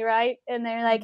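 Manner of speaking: normal talking voice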